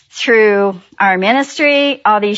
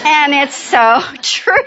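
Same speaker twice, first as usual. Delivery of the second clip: laughing through the words; giggling at the joke, drawn out; 5 semitones higher, slower than usual. Real, not acted.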